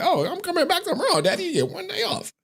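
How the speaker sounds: high-pitched little-kid impression; daughter's voice. Daughter warning Dad about her return, high mimicked child voice. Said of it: "She's like".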